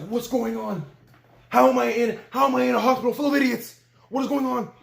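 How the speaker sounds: high-pitched, indignant disbelief; exasperated outburst. Retold or imitated. Patient stuck among bungling nurses, retold exasperation. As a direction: pitch it up, baffled and fed up.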